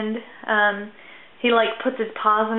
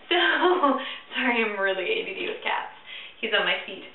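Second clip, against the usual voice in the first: usual speaking voice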